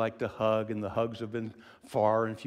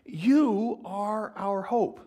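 loud, high preaching build; full-voiced sermon delivery; 6 semitones higher, 6 dB louder than usual. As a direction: preach it with rising fervour.

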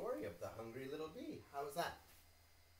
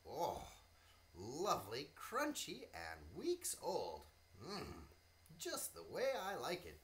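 higher, lively ant voice; cheerful little ant, pitched up; 5 semitones higher, 5 dB louder than usual. Acted. Ant character in story.

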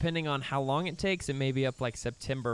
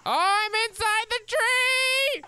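much higher, louder, slow squeal; surprised; 8 dB louder, slower than usual. Real, not acted. Gamer finding his dinosaur stuck inside a tree, surprised squeal. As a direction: shriek in disbelief.

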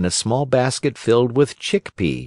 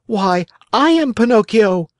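high puppet voice; eager Pinocchio; 12 semitones higher, 4 dB louder than usual. Acted. Marionette revealing himself to the pigeon, high and eager.

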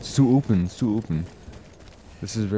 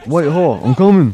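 louder, higher call to a comrade; 5 semitones higher, 7 dB louder than usual. Pretend. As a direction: call across the battlefield. Calling out to a game comrade.

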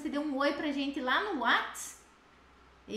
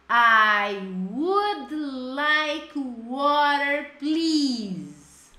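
"I would like water, please" very slow and louder; slow loud model; 5 dB louder, much slower than usual. Shown as a demonstration. Teacher modeling the answer for students, slow and loud. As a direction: say it slowly for the class to copy. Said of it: "vocês me ajudam a montar a frase".

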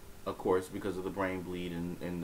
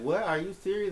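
retelling a shocked, half-awake question; stunned disbelief; 10 semitones higher than usual. Retold or imitated. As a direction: pitch leaps way up on serious. Waking in hospital, hearing bad news.

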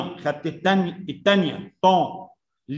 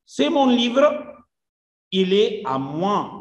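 slow reading; 3 semitones higher, slower than usual. Shown as a demonstration. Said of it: "teacher reads French sentence".

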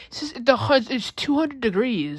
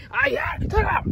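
indignant shout; 8 semitones higher than usual. Acted. Kids arguing over Taco Bell.

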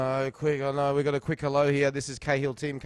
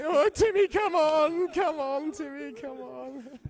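much higher, strained mock plea to Timmy; mocking teammates pleading; much slower than usual. Host mimicking teammates begging Timmy late in a match, hammed-up high plea. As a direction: ham up desperate teammates begging, fading off. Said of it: "they weren't saying that in the eighty-second minute".

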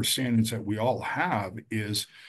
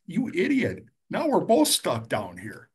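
high, slower scolding of a friend; exasperated scold; 8 semitones higher than usual. Retold or imitated. Joe scolds Charlie for jumping into the hole, high and slow. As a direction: scold your buddy for jumping in.

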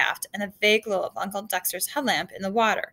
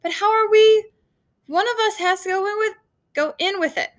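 high, loud shout easing off; shouting over storm; 11 semitones higher, 6 dB louder than usual. A narrator's reading. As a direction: argue at a shout, then let it drop. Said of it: "two men shouting at each other".

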